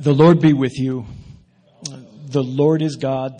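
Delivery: preacher's solemn call to worship; liturgical proclamation. Acted. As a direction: proclaim it to the congregation, slowing down.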